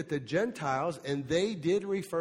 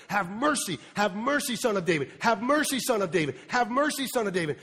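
mock desperate cry, louder and higher on each mercy; 4 semitones higher, 4 dB louder, faster than usual. Woman begging Jesus for mercy, rising loud plea. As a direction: plead loudly, climbing each time. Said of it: "She's crying out".

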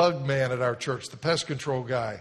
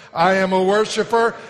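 louder and slower, rising in fervor; loud proclaiming; 5 semitones higher, 10 dB louder than usual. Shown as a demonstration. Pastor rallies congregation, loud fervent declaration. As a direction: belt it out as a declaration. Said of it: "Here we go".

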